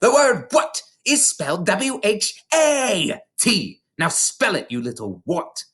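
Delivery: slow, high bellowing headmistress; Trunchbull shouting. A narrator's reading. Headmistress shouting at a pupil, slow and high. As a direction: spell out each letter like a drill sergeant. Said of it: "The Trunchbull was shouting".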